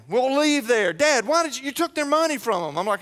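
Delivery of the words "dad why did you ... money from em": higher, quick daughter's complaint; daughter upset. Retold or imitated. Daughter scolding her dad after haggling, high and upset. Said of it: "She gets mad at me".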